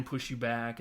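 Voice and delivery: ordinary talk, nothing marked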